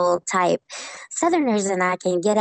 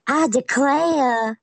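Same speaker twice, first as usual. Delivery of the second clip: mock Southern belle; 4 semitones higher, much slower than usual. Mocking how outsiders think Southerners talk, slow Southern belle drawl. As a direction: ham up the Gone with the Wind belle accent. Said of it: "They think that I should walk around and just say".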